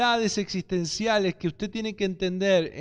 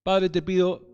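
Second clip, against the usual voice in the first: prayer; 3 semitones lower than usual. Real, not acted. Opens a prayer.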